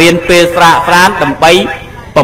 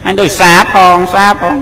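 higher mock mother complaining it is bland; a hint of a picky mother; fussy mother; 5 semitones higher than usual. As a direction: lightly voice the mother finding it bland. Mother voiced tasting the cooking.

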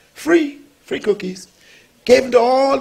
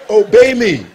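firm command; 7 dB louder than usual. Retold or imitated. Pastor commanding the woman.

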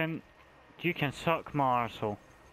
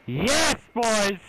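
high, loud victory shout; triumphant cheer; 9 semitones higher, 5 dB louder than usual. Real, not acted. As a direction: cheer your team on. Survived, cheering.

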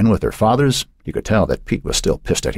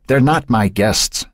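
shot back; 7 semitones higher, slower than usual. A narrator's reading. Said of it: "he shot back".

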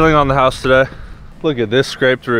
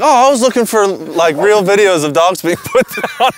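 disappointed viewer; 8 semitones higher than usual. Retold or imitated. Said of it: "They're like".

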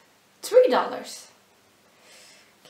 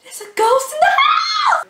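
high, loud scared shout; 9 dB louder than usual. Acted. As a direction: yell out in fake panic. Sudden shouted joke.

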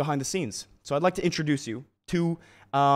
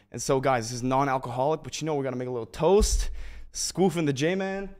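ordinary talk, nothing marked

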